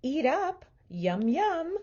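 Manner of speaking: sleep-talking mother feeding her pet; doting mother. A narrator's reading. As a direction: urge the pig to eat, sweetly. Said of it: "Missus Watson said in her sleep".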